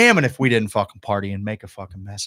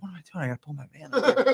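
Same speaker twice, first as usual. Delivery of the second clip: higher, much softer voice; mock befuddled thinking aloud; confused self-talk; 6 semitones higher, 6 dB quieter than usual. Man retelling his aimless wandering, in soft puzzled self-talk.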